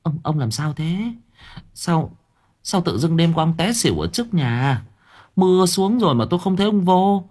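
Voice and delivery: put-on high-pitched, fussing older woman; flustered wife. A narrator's reading. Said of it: "Bà Loan rối rít". (giọng bà vợ cao, rối rít)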